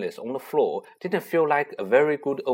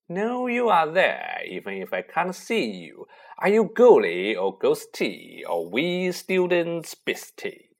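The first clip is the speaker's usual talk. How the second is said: sly poltergeist voice; 3 semitones higher than usual. Acted. Peeves taunting invisible students.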